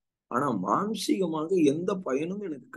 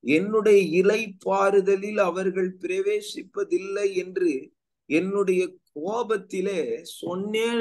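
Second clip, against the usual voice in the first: high, slow declamatory verse reading; raised, measured preacher's reading; scripture reading; 4 semitones higher, much slower than usual. Acted. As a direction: proclaim the verse, drawing the words out. Reads scripture aloud.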